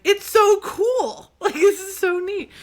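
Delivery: very high, loud, drawn-out gushing; self-mocking high-pitched excitement; gushing American tourist. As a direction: squeal with exaggerated tourist wonder. Mimicking herself as gushing American.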